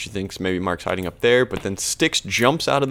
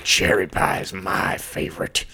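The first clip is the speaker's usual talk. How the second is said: rough, mostly voiceless character voice; Stanley; 3 semitones lower than usual. Retold or imitated. Stanley butting in about the pie, mock raspy voice. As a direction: rasp it out as a cartoon farmhand. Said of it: "Stanley's like".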